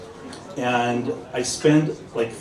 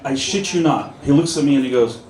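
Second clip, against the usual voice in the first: ordinary talk, nothing marked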